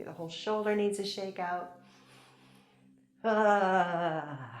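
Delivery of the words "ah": open, loud "ah" letting go of stress; a loud shaking-out "ah" sound; loud release sigh. Shown as a demonstration. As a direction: let the breath out in a loud sighing ah. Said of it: "great for letting out excess stress".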